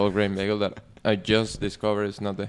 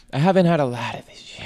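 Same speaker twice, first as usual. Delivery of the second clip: mock dramatic; 6 semitones higher, 5 dB louder than usual. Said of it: "such an issue".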